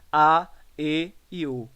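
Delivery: "a, e e u" with closed vowels, slowly. Shown as a demonstration. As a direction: sound out each of "a, e e u" slowly, closed. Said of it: "nas vogais fechadas". ("a, e e u" devagar, com vogais fechadas)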